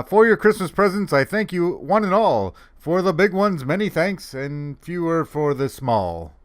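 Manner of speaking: high, loud sing-song verse; comic poem recital. Acted. Santa giving thanks for presents in verse, high sing-song. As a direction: declaim the rhyme high, slowing at the end. Reads a funny poem aloud.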